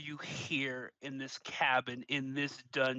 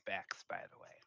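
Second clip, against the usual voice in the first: whispered aside; 7 semitones lower, 13 dB quieter, faster than usual. Real, not acted. Aside to the orc.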